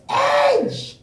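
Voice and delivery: sudden high, loud shout. Real, not acted. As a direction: blurt it out as a yell. Blurting out his own answer.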